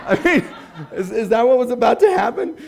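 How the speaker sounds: amused laughing. Real, not acted. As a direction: laugh through the puzzled question.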